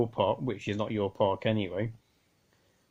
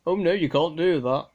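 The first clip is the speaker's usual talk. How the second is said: a louder hammed-up busybody protest; mock storming objection; 5 semitones higher, 5 dB louder than usual. Radio ham imitating a park busybody, mock loud objection. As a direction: play the busybody storming up to stop you. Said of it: "come storming up to you".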